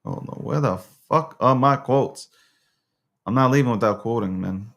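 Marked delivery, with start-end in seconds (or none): none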